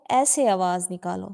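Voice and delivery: ordinary delivery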